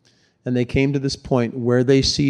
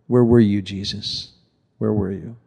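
low, hushed plea trailing off; grieving reproach; 5 semitones lower than usual. Acted. Acts Mary's grieving reproach to Jesus.

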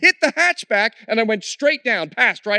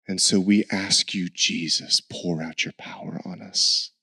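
closing prayer; 3 dB quieter than usual. Acted. Preacher leading the church in prayer, hushed and low. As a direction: lead the congregation into prayer, hushed.

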